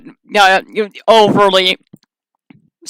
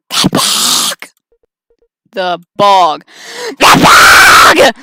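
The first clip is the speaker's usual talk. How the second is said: building from rasp to loud shout; creature shout; 8 dB louder, much slower than usual. Acted. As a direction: spit it out rising. Creature insists louder.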